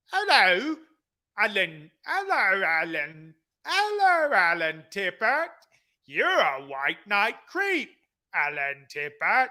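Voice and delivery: hammed-up British accent, sing-song Hello. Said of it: "I could even do it in a British accent".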